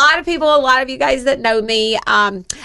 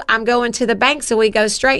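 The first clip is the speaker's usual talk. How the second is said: ordinary delivery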